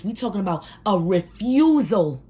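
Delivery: usual speaking voice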